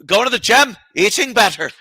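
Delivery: high-pitched mock accent, badly faked; sudden fake Irish-sounding accent; put-on accent. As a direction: imitate a comic slipping into a foreign accent. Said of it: "he said it just like that".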